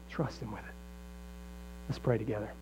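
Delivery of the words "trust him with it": soft low repeat. Real, not acted. Pastor to the congregation, softly and low. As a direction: drop the voice lower and much softer. Same urging, repeated much softer and lower.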